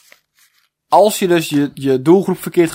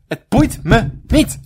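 slow, higher "boeit", "me", "niet" set apart; emphatic word by word; 4 semitones higher, slower than usual. Real, not acted. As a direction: drive home "boeit me niet" in separate beats. Last repeat, hammered word by word.

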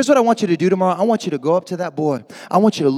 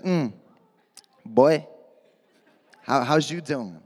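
softer, lower mock flirty drawl; 7 semitones lower, 7 dB quieter, slower than usual.

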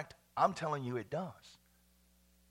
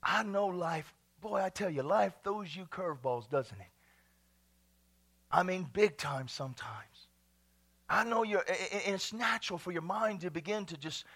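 ordinary delivery

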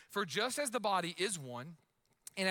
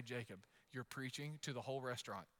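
much softer, hushed scolding aside; wife hushing; 9 semitones lower, 11 dB quieter than usual. Retold or imitated. Wife hushing husband preaching in a restaurant, soft scolding. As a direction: lean in and hush him like an embarrassed wife. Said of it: "My wife still this day".

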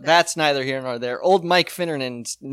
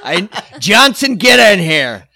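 bossy villain call; 2 semitones higher, 6 dB louder than usual. Pretend. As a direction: summon the henchman, loud. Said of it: "Sounds like a bad supervillain thing".

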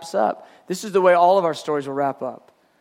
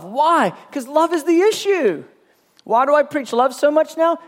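preaching; 7 semitones higher than usual. Real, not acted. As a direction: drive the point home from the pulpit. Preacher rises to full force.

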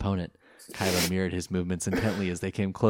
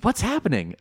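high, louder confused cry; confused demand; 12 semitones higher, 4 dB louder than usual. A narrator's reading. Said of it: "a confused Taya demanded".

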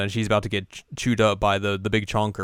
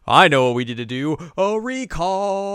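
scheming plotter; 8 semitones higher, 5 dB louder than usual. Retold or imitated. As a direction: sell the plan like a sly schemer. Recapping anime, Sumire's scheming line.

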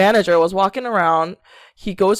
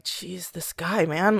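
softer, slow mutter; muttered aside; 8 dB quieter, slower than usual. Retold or imitated. Owner grumbling about the manager, slow quiet mutter. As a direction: mutter it under your breath. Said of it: "the owner was like".

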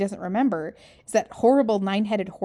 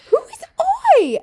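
baffled question; 10 dB louder, slower than usual. Retold or imitated. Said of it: "And I was like".

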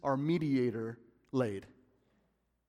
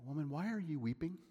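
softer, quick, gentle "Woman, why are you weeping?"; tender; 11 dB quieter, faster than usual. Shown as a demonstration. Preacher voices the angels tenderly to Mary. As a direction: say "Woman" with endearment, softly. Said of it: "This is a term of endearment".

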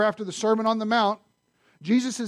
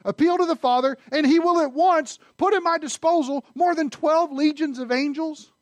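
declaiming; 8 semitones higher, 4 dB louder than usual. Acted.

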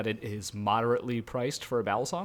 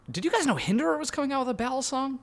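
high mock voice; 13 semitones higher than usual. Mock announcement about Hinderer.

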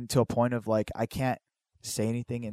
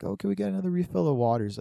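higher, quick polite request; imitating a sweetly asking mother; 8 semitones higher, faster than usual. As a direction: ask sweetly, as mom would. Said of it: "very sweetly".

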